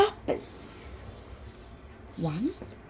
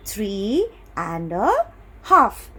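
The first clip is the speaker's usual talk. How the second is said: say-along modelling; slower than usual. Shown as a demonstration. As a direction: draw out "three and a", then punch "half". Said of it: "Say that".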